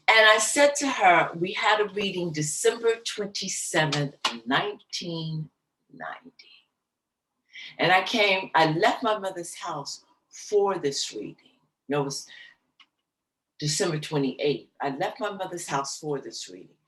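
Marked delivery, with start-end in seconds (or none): none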